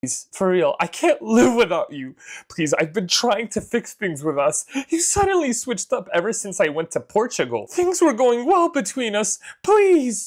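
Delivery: mock desperate boyfriend begging; begging cheater. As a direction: whine it out, rising to a squeak. Reading cheater's begging texts.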